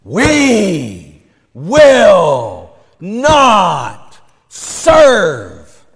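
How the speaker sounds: very slow, loud mock proclamation; imitating the nun pounding the podium; mock podium shout. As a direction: hammer each word like pounding a podium. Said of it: "she's pounding on the podium".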